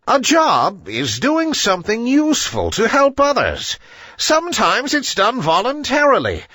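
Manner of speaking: put-on a wise old bird spelling it out; lecturing owl. A narrator's reading. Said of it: "hooted Owl".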